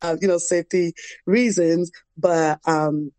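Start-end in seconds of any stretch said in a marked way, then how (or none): 0.0-1.9 high, quick, mocking disbelief with a laugh; incredulous retort. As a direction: toss it back at mom, half laughing. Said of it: "And I'm like".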